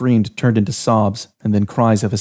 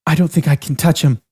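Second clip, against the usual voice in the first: a touch of trembling fear; lightly shaky, scared voice; quivering voice; 6 semitones higher than usual. A narrator's reading. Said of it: "her voice quivered".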